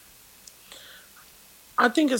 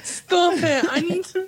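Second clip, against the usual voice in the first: trying not to laugh; 9 semitones higher, slower than usual. Real, not acted. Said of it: "Stop making me laugh".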